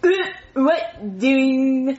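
badly faked puppet-kid voice; Jeffy. Auditioning to replace the puppeteer, awful impression. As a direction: try a silly character voice and miss. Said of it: "let me hear your Jeffy voice".

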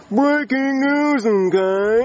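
very slow, high, stretched-out announcer impression; showy, slow newsman imitation; Mister Goodman. Shown as a demonstration. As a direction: draw out a character catchphrase grandly. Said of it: "I can do a mean Mister Goodman".

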